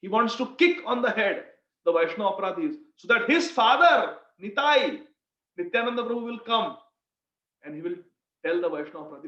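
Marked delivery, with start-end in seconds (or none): none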